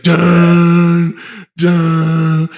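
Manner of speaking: high, booming sung-out movie sting; dramatic score imitation. Retelling Batman's walk from the flames, dramatic vocal sting. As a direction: mimic an ominous orchestral hit.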